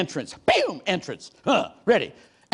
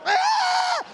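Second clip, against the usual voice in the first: high-pitched drawn-out "aaah"; comic squeal of being dragged along; mock scream. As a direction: wail in protest, very high. Imitates being dragged to the front.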